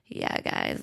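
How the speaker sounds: very low, hoarse voice; deep voice. Shown as a demonstration. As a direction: drop the voice way down. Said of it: "instinctually either go".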